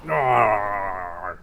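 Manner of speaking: long, low acted roar; monster roar. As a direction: roar like an angry beast, drawn out. Dialga roars.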